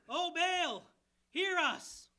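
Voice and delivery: loud, high desperate cry. Retold or imitated. Prophets of Baal crying to their god, loud and high. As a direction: wail it up to the sky.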